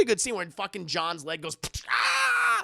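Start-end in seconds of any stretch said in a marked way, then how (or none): 1.9-2.6 high-pitched imitated scream; mock pain scream. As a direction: scream as if a leg just snapped. John's leg snaps.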